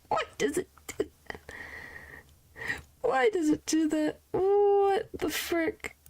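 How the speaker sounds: high, cracking laugh-talk; laughing squeal. Real, not acted. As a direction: giggle through the question. Laughing at bug.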